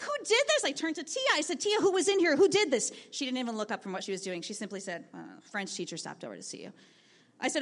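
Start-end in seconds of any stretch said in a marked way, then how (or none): 0.0-0.6 much higher squeal of delight; over the moon. Retold or imitated. Teacher finds classroom covered in posters, thrilled and much higher. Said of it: "I was over the moon". 1.6-2.9 higher, eager questions to the aide; excited. Retold or imitated. Teacher asks the aide who made the posters, eager and higher. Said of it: "I was over the moon". 5.2-6.7 offhand. Retold or imitated. Aide answers without looking up.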